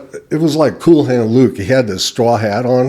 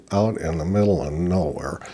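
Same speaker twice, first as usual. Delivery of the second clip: ordinary talk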